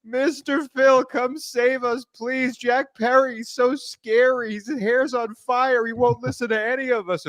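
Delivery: hammed-up high pleading voice; mock pleading crew. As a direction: beg like a terrified child. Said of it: "they go crawling on their hands and knees".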